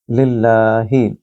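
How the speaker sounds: लिल्लाही with a thin, light ल, slowly. Shown as a demonstration. Teacher demonstrating the word, light slow l. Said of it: "लिल्ला नहीं पढ़ सकते क्योंकि ये तरकीक है".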